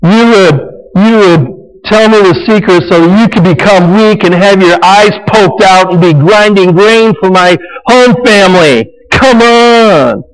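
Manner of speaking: mock whiny Delilah. Delilah nags Samson to give up his secret, whining high. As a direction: wheedle it, sickly sweet and pushy. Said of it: "almost like she's saying".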